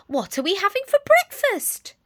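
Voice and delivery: put-on high, loud old lady question; curious granny. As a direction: ask brightly as a sweet grandma. Granny voiced in story.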